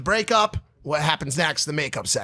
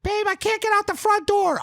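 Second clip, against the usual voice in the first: much higher whiny imitation of a panicked girlfriend; high mock girlfriend. Boyfriend mimicking girlfriend trying to leave at night, high and whiny. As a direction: squeak it up high like a frantic girlfriend. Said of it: "she goes".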